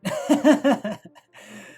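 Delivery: loud high burst of laughter; big laugh. Real, not acted. Laugh after Sarada's thought.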